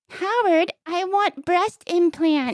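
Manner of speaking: lightly voiced wife. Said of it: "When are you gonna do a voice".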